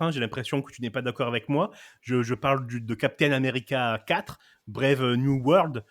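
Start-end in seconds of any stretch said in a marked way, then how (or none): 4.7-5.8 « Brave New World » dit lentement à l'anglaise; détacher « Brave New World » en anglais; prononciation anglaise. Shown as a demonstration. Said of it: "avec la bonne prononciation". (l'animateur cite le titre en anglais, lentement)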